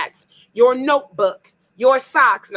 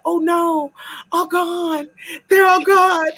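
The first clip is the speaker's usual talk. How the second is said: high, whiny mock grief over the empty box; mock drunken lament; 8 semitones higher, 4 dB louder, slower than usual. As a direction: wail it like a drunk child losing candy.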